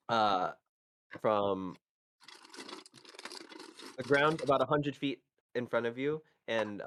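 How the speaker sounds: scene-setting storyteller voice; game master narration. Acted. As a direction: set the scene for the players. GM describes the battle scene.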